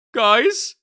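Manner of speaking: high loud call into darkness. Acted. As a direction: call out anxiously. Calling into the dark.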